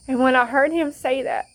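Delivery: high, loud voice breaking with emotion; overcome, voice rising as tears come; testifying about God, crying out loud; real tearful emotion. Real, not acted. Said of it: "I began to cry".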